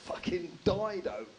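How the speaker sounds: slow, appalled mum; lightly voiced shocked mum; horrified mum. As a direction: drag it out, aghast. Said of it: "She was like".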